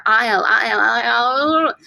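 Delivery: "I L" repeated and slurred into a tangle; sounds colliding. Shown as a demonstration. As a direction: blur "I L" together as if tripping on it. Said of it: "two similar sounds next to each other".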